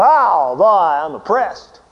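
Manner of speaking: mock the put-upon thirteen-year-old; mock whiny kid. Preacher mocking a teen complaining to parents, sulky and slow.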